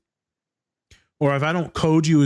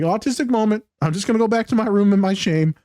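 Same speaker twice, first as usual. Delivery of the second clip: higher, self-mocking mumble; mock shame; 6 semitones higher than usual. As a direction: play it up as sheepish. Retold embarrassed self-talk.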